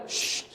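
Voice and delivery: soft "shh" to quiet someone; hushing shush. Retold or imitated. Father shushing his son on the plane, hushing.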